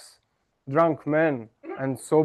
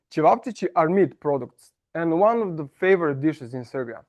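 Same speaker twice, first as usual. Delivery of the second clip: usual speaking voice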